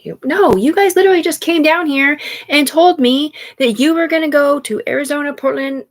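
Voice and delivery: loud, high-pitched complaint; heated rant. Real, not acted. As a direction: let it all pour out, worked up. Ranting about money owed to her.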